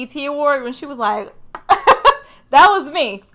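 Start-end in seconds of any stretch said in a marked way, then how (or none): 1.5-3.2 laughing. Real, not acted. Laughing at her own excitement.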